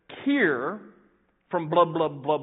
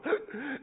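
panicked gasp; 8 semitones higher, 5 dB quieter than usual. Retold or imitated. Boy panics in water.

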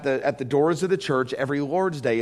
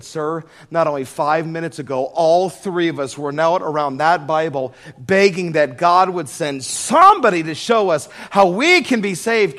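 rising, louder plea building to a cry; begging through tears for help, swelling louder; a woman's desperate plea to the visitor, building louder; tearful plea; 2 semitones higher, 7 dB louder than usual. Retold or imitated.

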